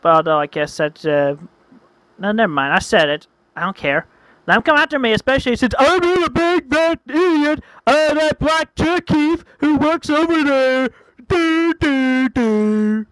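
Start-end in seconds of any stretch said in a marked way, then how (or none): 4.5-13.1 mocking singsong. Storyteller daring critics to come after him, mocking singsong. Mocking aside about himself.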